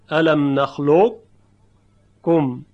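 slow recited الم نخلقکم, heavy ق colour before a doubled ک; heavy qaf kept. Shown as a demonstration. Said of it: "اما مخرج قاف دیگه تلفظ نمیشه".

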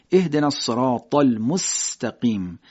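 slow "المستقیم" with a plain sharp سین hiss; clear hissed s. Shown as a demonstration. Teacher reciting slowly to show the سین. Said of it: "این صدا باید شنیده بشه".

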